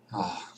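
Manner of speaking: soft, low breath out; sigh of relief. A narrator's reading. Charlie hears his brother is free to leave, relieved sigh. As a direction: let out a quiet, easing "ah". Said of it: "Charlie breathed more easily".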